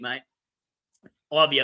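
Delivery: usual speaking voice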